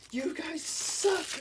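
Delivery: high, drawn-out jeer imitating a ranter; mock rant. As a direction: stretch it out high, laying into them in jest. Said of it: "he really laid into him".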